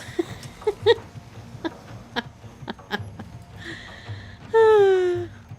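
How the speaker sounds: extended gleeful laughter; long high laugh. Real, not acted. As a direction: laugh hard, delighted. Long laugh after kill.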